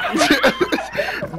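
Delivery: high-pitched giggling fit; real high laughter; laughing hard. Real, not acted. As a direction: crack up laughing. Cracking up over the hairline joke.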